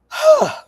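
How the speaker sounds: loud, high exhaled sigh; big sigh before moving on; heavy sigh. Real, not acted. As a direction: let out a big audible sigh. Resets after technical trouble remarks.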